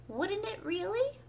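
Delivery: pitched high, amazed question; great surprise. A narrator's reading. Alice surprised at the Mock Turtle, high amazed question. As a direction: sound truly astonished. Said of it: "in a tone of great surprise".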